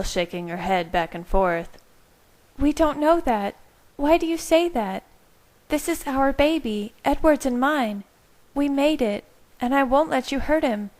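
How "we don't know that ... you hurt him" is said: higher voiced, firm pleading; Bella. A narrator's reading. Bella tells Carlisle she won't give up the baby, firmly. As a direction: Bella refusing, higher and determined.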